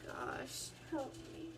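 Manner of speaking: whispered under her breath; a caught teen whispering; whispered dread. Retold or imitated. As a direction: whisper a panicked prayer. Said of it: "And I'm like".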